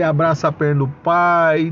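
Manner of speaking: normal talking voice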